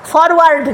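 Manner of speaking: ফরওয়ার্ড with both r's and a full "ward"; common mispronunciation. Shown as a demonstration. Teacher shows how Bengalis say it, common mispronunciation. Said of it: "আমরা কিন্তু তাই বলে ফেলি".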